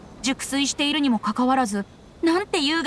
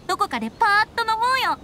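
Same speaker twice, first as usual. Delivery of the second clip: higher and faster, eager to party; excited invitation; 10 semitones higher, faster than usual. Acted. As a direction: pitch it up, suddenly keen. Proposing a night of drinking.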